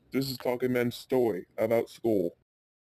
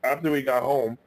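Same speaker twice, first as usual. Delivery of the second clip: ordinary delivery, nothing marked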